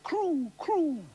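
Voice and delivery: high falling crew crew; frog call. Retold or imitated. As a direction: croak it up high. Frog sound imitated.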